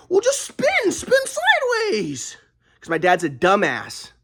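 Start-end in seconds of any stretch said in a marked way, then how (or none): 0.1-2.4 much higher, slow mocking dad voice. Son mocking dad's shooting advice, high and slow. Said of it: "my dad's like".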